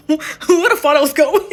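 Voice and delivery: loud, high laughing question; laughing confusion. Real, not acted.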